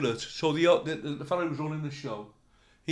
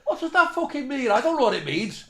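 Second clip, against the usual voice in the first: indignant; 7 semitones higher, 4 dB louder than usual. Retold or imitated. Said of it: "So I went".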